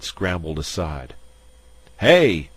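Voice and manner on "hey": sharp loud high yell. Acted. Bullethead yelling as his tower falls over. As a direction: shout him down in alarm. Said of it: "Bullethead yelled".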